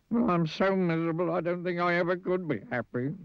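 high, woeful squeak; miserable. Acted. Mister Miserable doubts he could be happy, miserably. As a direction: wail it pitifully on one high note. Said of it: "he added miserably".

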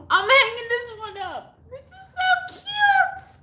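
squealing delight. Real, not acted. Gushing over a Texas gift.

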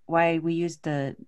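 ordinary delivery

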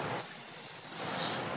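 long, soft unvoiced S; isolated S sound. Shown as a demonstration. Teacher demonstrating the letter's sound to class, isolated S. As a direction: hold a sustained sss hiss. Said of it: "pronounce daw".

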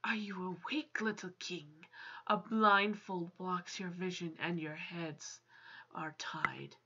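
low, hushed storytelling read; quiet, low-pitched game narration; story narration. Acted. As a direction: read the scene aloud, soft and low. Reads the game's story aloud.

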